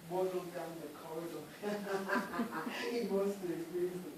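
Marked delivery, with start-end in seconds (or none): 1.5-2.9 short chuckle; light laugh before the twist; amused laugh. Real, not acted. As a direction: laugh at the foolish man. Narrator laughs at Oba.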